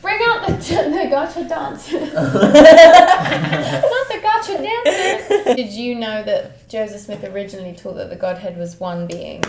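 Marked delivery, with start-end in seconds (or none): 0.0-5.6 high, slow words broken by laughter; laughing through a mock announcement. Real, not acted. Joking with the hosts about Joseph Smith, laughing hard. Laughing call for gotcha dancers.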